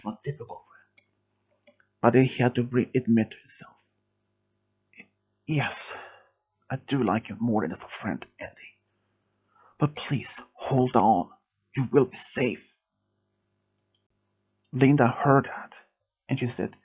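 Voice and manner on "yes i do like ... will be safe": soft, gentle reassurance; tender hushed confession. A narrator's reading. Milo confessing to wounded Andy, tender and hushed. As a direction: confess softly, then reassure.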